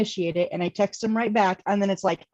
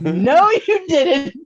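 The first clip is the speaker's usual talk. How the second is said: loud, high, drawn-out disbelief; mock outrage; 7 semitones higher, 8 dB louder, much slower than usual. Scolding a lover who mentioned an ex, mock outrage. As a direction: stretch it loud and high, laughing.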